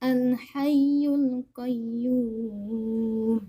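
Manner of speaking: Al-Hayyul Qayyum very slow, the doubled ya in Hayyul held and pressed; Al-Hayyul Qayyum with the shadda on ya fully stressed and drawn out; over enunciating. Shown as a demonstration. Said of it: "sempurnakan tasdidnya".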